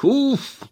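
a sharp high "ugh" of disgust; disgusted huff. Acted. Aunt ends her mockery of the brother with a high disgusted huff. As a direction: spit out the disgust. Disgusted exclamation after parody.

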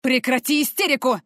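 loud barked order. A narrator's reading. Stranger barks at the hysterical girl, loud. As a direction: bark it to cut her hysteria. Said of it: "рявкнул мужчина".

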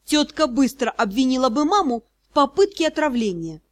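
ordinary delivery, nothing marked

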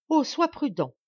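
insistent mother's plea; anxious plea. Acted. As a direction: beg him to be careful, worried. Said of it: "insista madame d'Escorval".

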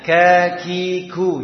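kakiku sung slowly with breathy aspirated kafs; slow demo of kakiku; kaf with breathiness. Shown as a demonstration. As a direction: breathe a little air after each k in kakiku. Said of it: "sifat hamas".

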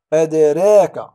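"أدراك" loud, the d bounced as a-d(e)-raka; قلقلة صغرى. Shown as a demonstration. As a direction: punch and release the d in "أدراك". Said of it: "لا نقول أدراك". (قلقلة الدال في "أدراك" بصوت عال)

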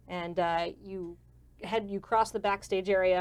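ordinary talk

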